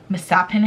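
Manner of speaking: "mishappen" said as mis-HAPPEN; misread misshapen. Shown as a demonstration. Owning up to a reading mistake, "mishappen" said as mis-happen. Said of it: "in my head, it was mishappen".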